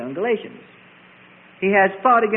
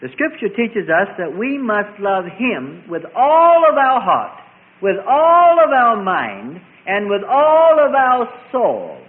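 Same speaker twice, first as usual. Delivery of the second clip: louder, slow, rising preacher's cadence; preaching at full force; 2 semitones higher, 6 dB louder, slower than usual. Acted. Preacher proclaims to the congregation, loud and slow. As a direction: build it up word by word.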